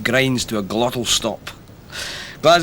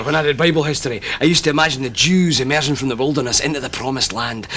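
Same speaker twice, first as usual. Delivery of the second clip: ordinary delivery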